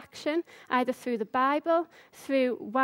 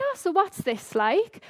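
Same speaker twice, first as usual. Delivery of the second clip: bright-eyed eager question, pitched higher; acted enthusiasm, lifted in pitch; enthusiastic; 5 semitones higher than usual. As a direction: retell an all-keen opening question. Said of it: "All enthusiastic".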